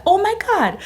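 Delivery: high, loud mock gasp of disbelief; shocked. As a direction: react like your idol just wrote back. Shocked the author replied.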